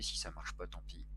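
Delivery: low, soft mutter to himself; quiet, low resignation; muttered aside. Real, not acted. First, muttered take of repeated phrase.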